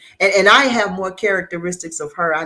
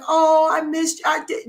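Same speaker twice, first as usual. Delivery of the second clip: mock sappy reunion; 11 semitones higher than usual. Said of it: "Paula stories where it was like".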